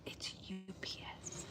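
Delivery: hushed whisper, hiding from the driver. Real, not acted. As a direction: whisper so nobody outside hears. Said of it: "I feel like I have to whisper".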